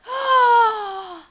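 long, high, loud gasp; shocked gasp. Real, not acted. Player seeing her bloodied horse, shocked gasp. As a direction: gasp loudly in horror at the sight.

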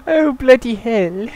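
mock English accent. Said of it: "that was completely unintentional".

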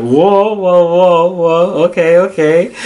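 acting out being pleasantly overwhelmed; amazed reaction. Retold amazed reaction to English.

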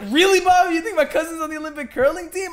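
high, loud incredulous mockery; mocking. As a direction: scoff at him in disbelief. Friend mocking him.

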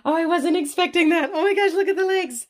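high, loud burst of delight; thrilled gasp. Real, not acted. Mom unboxing her reborn doll, loud excited squeal. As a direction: squeal it out, unable to contain joy. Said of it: "all the gasping".

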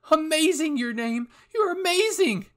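very high, loud squeal of excitement; overjoyed screaming praise; ecstatic praise. Acted. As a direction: shriek with admiration. Hanjay excitedly praising the winner.

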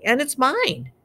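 high-pitched indignant insistence; furious. A narrator's reading. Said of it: "Harry furiously".

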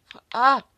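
cri aigu et fort; cri de douleur. Real, not acted. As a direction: crier de surprise sous le coup. Frappé par un monstre. (cri soudain)

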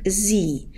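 "Zi" said with a voiced z and a long i; sie with z sound. Shown as a demonstration. Teacher models "Zi" for students, voiced z and long i. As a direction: say "Zi" like the Romanian word for day, z and long ee. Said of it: "s-ul să-ți pronunțați ca z".

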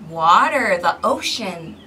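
ordinary delivery, nothing marked

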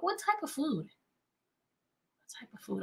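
soft, drawn-out coaxing question; teacher nudging students to answer, soft and slow; coaxing whisper. Acted. Teacher prompting class softly.

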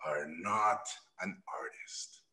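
slow whispered verdict from a harsh teacher; crushing whisper. Retold or imitated. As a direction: lean in and say it quietly into his soul. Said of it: "and he goes".

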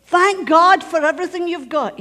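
loud, high command from Dad; exhorting father. Retold or imitated. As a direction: imitate a father firmly exhorting his children. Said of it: "he would say".

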